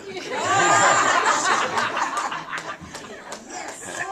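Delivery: laughing. Real, not acted. Laughs at own joke.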